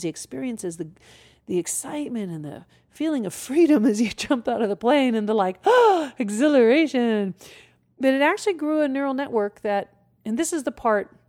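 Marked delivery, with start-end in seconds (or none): 5.6-6.1 sharp thrilled gasp of rushing air; excited gasp. Retold or imitated. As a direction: gasp like leaping from a plane. Reliving jump out of plane.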